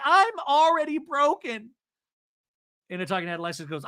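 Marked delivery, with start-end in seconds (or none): 0.0-1.7 high, slow hammed-up wail; mock broken wail. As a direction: imitate a dramatic breakdown, stretched out. Acting out Alexis's meltdown.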